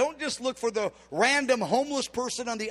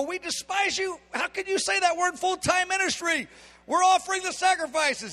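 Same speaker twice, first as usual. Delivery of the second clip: higher, whiny voice of the offended leaders; mock the leaders' wounded innocence, pitched up; indignant protest; 8 semitones higher than usual.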